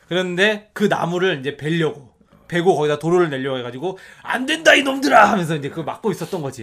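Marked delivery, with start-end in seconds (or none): 4.2-5.3 put-on loud, high old man yell; old man shout. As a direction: shout like an angry grandpa.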